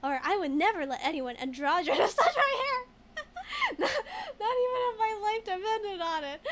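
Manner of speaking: loud, laughing through a mock refusal; laughing mock outrage. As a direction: ham up the outrage while cracking up. Mock-reading a dialogue choice aloud.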